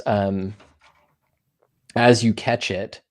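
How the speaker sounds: ordinary talk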